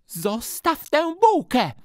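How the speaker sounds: very high, loud, slow yell; shouting. Acted. Teacher yells at Alcest over his roll, high and loud. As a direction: snap the order across the yard. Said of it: "krzyknęła pani".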